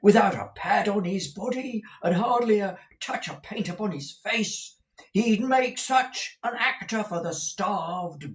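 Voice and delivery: high, stagey enthusing pitch; the manager raves about Smike's face; emphatic manager. A narrator's reading. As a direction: sell the boy's talent with gusto. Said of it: "striking his knee emphatically".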